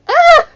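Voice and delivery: piercing high scream of sudden pain; sharp cry of pain. A narrator's reading. Padme cries out in sudden sharp pain, screaming high. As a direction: cry out as a cramp hits. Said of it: "She called out".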